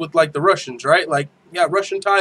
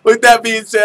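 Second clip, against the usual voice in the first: loud, high, still recovering from a laughing fit; amused and raised; 7 semitones higher, 7 dB louder than usual. Real, not acted. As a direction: push the sign-off out through laughter. Still laughing while closing the show.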